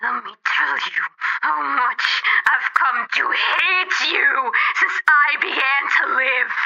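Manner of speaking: soft, breathy menace, rising into a strained high cry; a cold machine whispering its hate; a hushed voice of pure hatred building to a cry; AM's hatred. Acted. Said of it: "Am said it with a sliding, cold horror".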